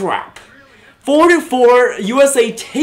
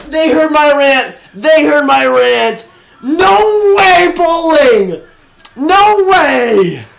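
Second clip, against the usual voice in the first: louder, higher, joyful yelling; excited shouting; 6 semitones higher, 4 dB louder, slower than usual. Real, not acted. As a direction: keep yelling in happy disbelief. Still celebrating the goal.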